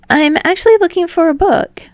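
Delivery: high, loud and bright; eager young man. A narrator's reading. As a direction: say it a bit nervously. Hinata voiced.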